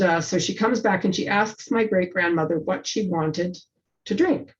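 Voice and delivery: normal talking voice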